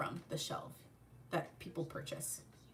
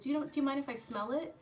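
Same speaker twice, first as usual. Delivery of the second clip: polite mom; 8 semitones higher, faster than usual. Retold or imitated. Mom asks politely, voiced.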